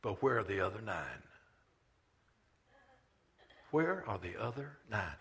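Jesus quietly grieving the nine; sorrowful question. Acted. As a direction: ask it softly like a hurt friend. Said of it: "he asked this question".

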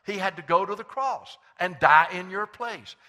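loud, high-pitched pulpit preaching; full-voiced sermon delivery. Real, not acted.

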